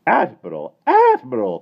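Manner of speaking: high, loud protest; prim lady shocked by curses; shocked cry. A narrator's reading. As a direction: cry out scandalized. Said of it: "cried Miss Lark".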